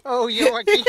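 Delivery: loud, high burst of laughter; laughing hard. Real, not acted. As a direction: crack up at a friend. Friend caught again.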